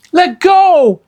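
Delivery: put-on high, loud girl's shout. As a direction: yell it into his face. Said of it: "Annie shouted".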